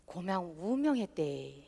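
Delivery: slow, soft Gyeongsang dialect. Shown as a demonstration. Host demos how Gyeongsang folk announce a death, soft and slow. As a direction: mumble it the Gyeongsang way, quietly. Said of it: "경상도에는".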